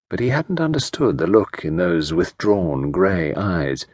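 plain reading voice

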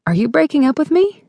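high, rising disbelieving question; taken aback, voice lifting high; incredulous question. A narrator's reading. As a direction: ask it in disbelief, pitch climbing. Narrator acts heroine being dumped.

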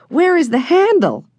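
put-on high, delighted little-boy whoop; excited cry. A narrator's reading. Benny thrilled to push the blast handle, crying out. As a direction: burst out with glee. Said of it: "cried Benny".

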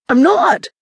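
high, emphatic denial; quick defensive burst. A narrator's reading. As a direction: protest it with pitch jumping up. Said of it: "Jason defended himself".

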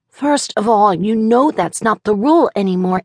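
put-on high, know-it-all little boy; child voice. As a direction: argue the point like a six-year-old.

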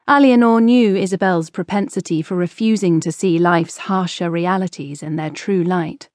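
plain reading voice

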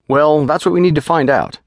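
quick, businesslike physician giving orders; lightly voiced clinical doctor; brisk doctor.

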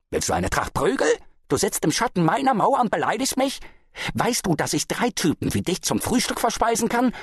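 high, blustering challenge; threatening. A narrator's reading. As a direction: intimidate with rising indignant questions. Husband threatens the farmer in quarrel.

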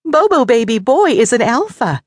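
high, loud, insistent mother; mother raccoon. A narrator's reading. Mother explains name to doubter.

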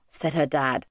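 ordinary delivery, nothing marked

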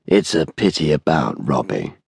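understated, smooth regret. A narrator's reading. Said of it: "said smoothly".

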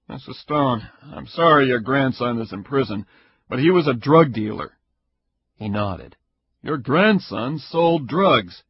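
stern investigator, irritated. Acted. Caperuzzi threatening Ida with a complaint, loud and fast. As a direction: push through it like an angry official.

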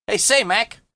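put-on high, chirpy boy's hail; cheerful cry. A narrator's reading. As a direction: call out brightly to a mate. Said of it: "cried Harvey cheerfully".

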